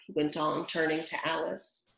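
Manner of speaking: plain reading voice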